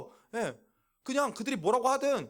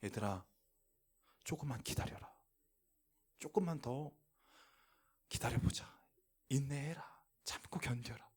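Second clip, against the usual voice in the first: low, hushed, patient urging; gentle voice of the Lord; 7 semitones lower, 10 dB quieter than usual. Acted. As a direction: drop low and soft, pausing between phrases. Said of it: "그럼 주님이 말씀하십니다".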